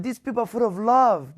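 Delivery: a retold cry of wonder; moved exclamation. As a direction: quote him overwhelmed with feeling. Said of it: "He said".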